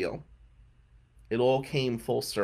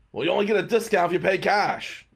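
lightly voiced pushy clerk, higher pitch; rude hotel clerk; 6 semitones higher, 4 dB louder than usual. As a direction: imitate a clerk laying down terms.

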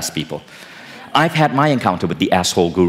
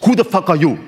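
angry guru; 8 semitones higher than usual. Retold or imitated. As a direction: bark it at the stranger like an arrogant guru. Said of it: "the guru goes".

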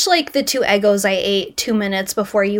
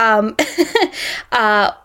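usual speaking voice

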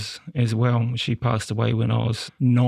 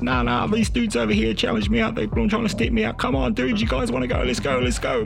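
agitated shouting; 11 semitones higher than usual. Retold or imitated. Wild challenge to phantom dudes.

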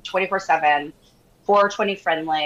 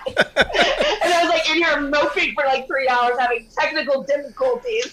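higher, talking through laughter; laughing; 7 semitones higher than usual. Real, not acted. Guest explains her ruined day to hosts, laughing. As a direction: giggle helplessly while explaining.

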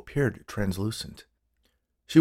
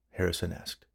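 usual speaking voice

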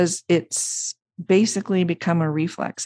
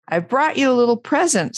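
quick, high-pitched excitement; Pooh, excited; 8 semitones higher, faster than usual. A narrator's reading. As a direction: burst out eagerly with news of a gift. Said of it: "said Pooh excitedly".

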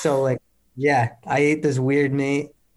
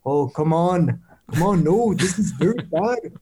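imitating the coach's Austrian accent, pitched up; mock Austrian accent; 4 semitones higher than usual. Athlete mimicking the Austrian coach for laughs, mock Austrian accent. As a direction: tease with an exaggerated Austrian accent. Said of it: "specific accent ... we'll all do it".

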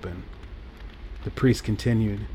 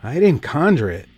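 higher, louder protest of innocence; insisting; 5 semitones higher, 5 dB louder than usual. A narrator's reading. Paul denying it to the priest, insistent. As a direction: push back hard, defending yourself. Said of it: "Paul insisted".

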